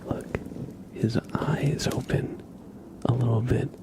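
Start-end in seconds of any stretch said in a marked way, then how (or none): none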